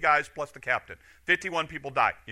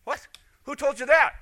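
higher-pitched startled question; mock surprise; 9 semitones higher than usual. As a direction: act out a startled king demanding an answer. King reacts to death message.

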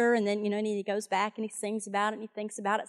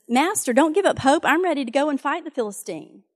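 eager pledge; 4 semitones higher, 6 dB louder than usual. Retold or imitated. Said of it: "he says".

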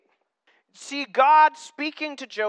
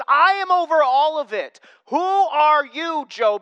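loud, higher, drawn-out challenge; God challenging Job; 5 semitones higher, 5 dB louder than usual. Acted. God confronting Job over who rules, booming slow challenge. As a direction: thunder it slowly as God rebuking Job. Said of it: "And he goes".

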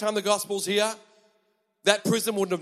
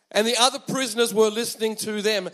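usual speaking voice

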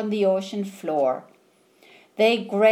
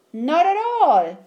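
higher, louder cheery pebbles; pebbles' reply; 9 semitones higher, 5 dB louder than usual. A narrator's reading. Pebbles agreeing to hermit crab, higher and louder. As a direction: answer as a chorus of willing pebbles.

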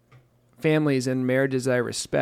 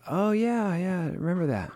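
sheepish remembering; 5 semitones higher than usual. Retold or imitated. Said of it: "I'm like".

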